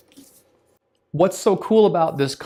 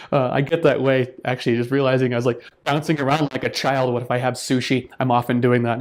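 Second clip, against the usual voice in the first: normal talking voice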